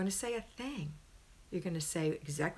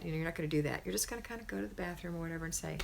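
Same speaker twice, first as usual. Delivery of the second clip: usual speaking voice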